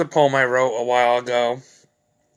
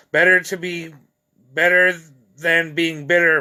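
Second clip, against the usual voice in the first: higher-pitched, measured poetry reading; poem recitation; 3 semitones higher than usual. Acted. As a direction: recite each line deliberately, drawing out the endings. Reads own poem aloud.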